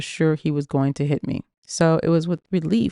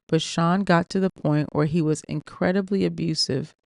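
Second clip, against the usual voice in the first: ordinary delivery, nothing marked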